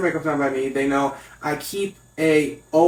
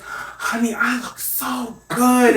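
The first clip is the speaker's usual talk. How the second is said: higher-pitched, drawn-out self-admiring granny; imitated grandmother; 7 semitones higher, much slower than usual. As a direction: preen as a grandmother, slowly stretching the words. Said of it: "And she goes".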